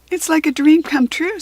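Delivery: put-on overjoyed girl gushing; thrilled young girl. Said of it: "said Kirsty".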